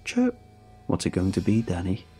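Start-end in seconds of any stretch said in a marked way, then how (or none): 0.1-0.4 shrill insect chirp sound; high creature chirp. Acted. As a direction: chirp like a giant bug.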